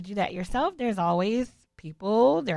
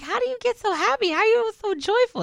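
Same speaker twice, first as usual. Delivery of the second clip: much higher, delighted wonder; bright admiring question; 12 semitones higher, 4 dB louder than usual. Retold or imitated. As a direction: ask in genuine amazement. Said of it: "You see the difference?".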